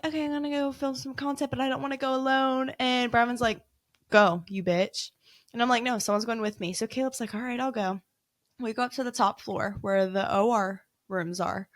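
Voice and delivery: normal talking voice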